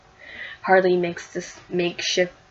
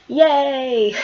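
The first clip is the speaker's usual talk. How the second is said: little cheer; 5 semitones higher, 6 dB louder than usual. Real, not acted. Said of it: "cheering the third story".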